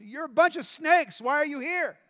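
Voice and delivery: high loud scolding; preacher opening with an insult; shouted rebuke. Acted. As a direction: blast the crowd for coming. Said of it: "This is how he's starting the message".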